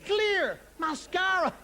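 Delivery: slow mock disbelief. Shown as a demonstration. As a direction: drag it out in disbelief. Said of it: "repeated for emphasis".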